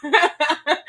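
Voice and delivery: laughing tease. Real, not acted. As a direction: announce it while cracking up. Repeats the tease to viewers, laughing.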